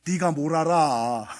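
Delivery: slow, belittling put-down; dismissive. Retold or imitated. Parent brushing off a child's feelings, slow and dismissive. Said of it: "감정을 무시하는 말은 어떤 말이냐면".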